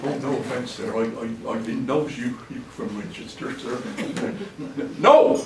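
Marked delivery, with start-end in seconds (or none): 0.1-5.0 much softer, lower backtracking; quietly awkward, trying not to offend; sheepish apology. Acted. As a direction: drop to an embarrassed mumble after a gaffe. Apologises for insulting listener's town.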